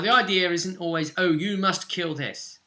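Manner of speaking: ordinary talk, nothing marked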